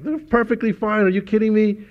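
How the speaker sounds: high mock dad, disbelieving; dad brushes off son's request, high pitched; incredulous dad. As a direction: scoff like a dad refusing new cleats. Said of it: "My dad was like".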